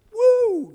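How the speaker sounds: put-on cheering kids' woo; excited whoop. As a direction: whoop like a kid at camp. Kids cheering at worship.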